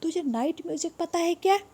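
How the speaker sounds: voiced, high "तुझे night music पता है क्या?" rising on "क्या"; donkey voice. Shown as a demonstration. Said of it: "या शायद डोंकी पूछ रहे". ("तुझे नाइट म्यूजिक पता है क्या" ऊँची गधे की आवाज़ में)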